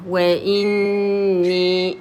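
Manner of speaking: slow وإني, held n then two-beat final ee; two-count ya. Shown as a demonstration. Teacher gives the ya two periods, slowly prolonging وإني. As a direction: stretch both the n and the ee of وإني. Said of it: "I give it two periods".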